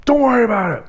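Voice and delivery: high, loud, snappish brush-off; re-enacted angry shout; yelling. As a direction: yell it back at your friend to shut them up. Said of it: "continued to yell at him".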